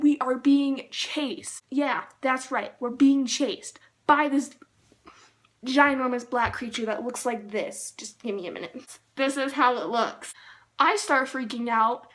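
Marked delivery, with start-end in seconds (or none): none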